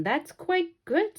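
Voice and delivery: drawn-out appreciative comment; impressed man. Acted. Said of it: "said the man".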